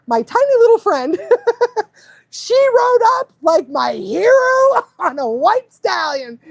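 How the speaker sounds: high, bright and laughing; excited. Retold or imitated. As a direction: gush about the hero friend, laughing. Excited retelling of rescue.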